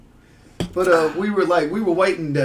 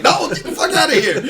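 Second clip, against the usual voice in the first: high, laughing mock shooing-away; laughing retort; 10 semitones higher, 4 dB louder than usual. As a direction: crack up while telling someone off. Comic retort told while cracking up.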